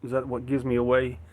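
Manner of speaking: normal talking voice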